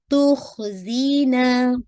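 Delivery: तो खुजीना slowly with a heavy kha; slow recitation demo. Shown as a demonstration. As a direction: stretch the ना for two counts. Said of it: "आपने खा को heavy करना है".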